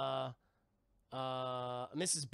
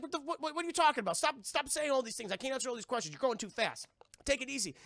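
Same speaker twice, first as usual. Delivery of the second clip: high, stammering overwhelmed uncle; flustered protest; 7 semitones higher, faster than usual. Retold or imitated. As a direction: beg them to stop, pitched up. Scrooge flustered by rapid questions.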